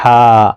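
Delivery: ہا breathed gently from the throat; soft ha. Shown as a demonstration. Teacher modelling a gentle breathy ہا to repeat. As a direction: let ہا out easily and softly. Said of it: "ایسے بہت آرام سے".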